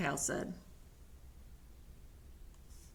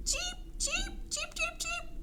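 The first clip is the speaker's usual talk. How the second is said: tiny, very high, soft cheeps; delighted cheeping; 6 dB quieter than usual. Acted. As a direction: peep happily like a chick. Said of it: "Turtle exclaimed with delight".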